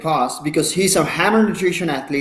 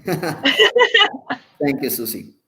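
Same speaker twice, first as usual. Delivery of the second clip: amused, laughing thank you; much slower than usual. Real, not acted. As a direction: let the laugh spill into the thanks.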